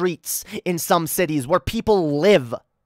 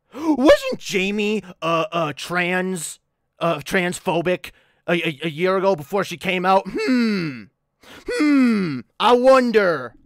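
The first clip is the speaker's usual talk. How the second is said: mock suspicion. Streamer mimicking Paul questioning her transness, mock suspicion. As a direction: imitate someone feigning innocent doubt. Mocks Paul's insinuating questions.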